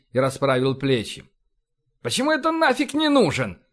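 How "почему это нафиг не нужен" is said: high-pitched indignant protest; indignant flare-up. A narrator's reading. Essex protests at being dismissed, high and indignant. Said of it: "взвился Эссекс".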